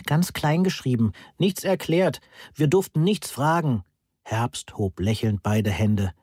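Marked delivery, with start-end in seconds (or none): 0.0-3.8 higher-pitched, mildly indignant complaints; exploding complaints. A narrator's reading. Students complaining to the professor about the substitute, mildly indignant. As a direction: complain in short bursts, pitch pushed up. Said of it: "explodierte der Hörsaal mit Beschwerden".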